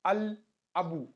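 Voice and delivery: "al abu" with the l clearly sounded; lam pronounced. Shown as a demonstration. Teacher shows learners the moon letter rule, "al abu" with l sounded. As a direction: sound the l before the vowel in "al abu". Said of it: "then lam will be pronounced".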